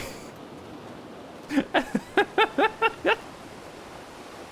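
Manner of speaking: breathless giggle; high wheezy laugh. Real, not acted.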